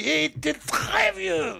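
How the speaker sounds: put-on high, shouted child voice; another little kid. Second child butting in about an old man, high imitated kid shout. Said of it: "il y a un autre qui est arrivé qui dit".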